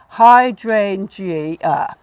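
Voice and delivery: very slow "hydrangea", DRAIN drawn out; hammed-up hy-DRAIN-gea, stretched; overdone stress on DRAIN. Shown as a demonstration. Teacher exaggerating the stress for a student: hy-DRAIN-gea. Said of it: "I was going over the top".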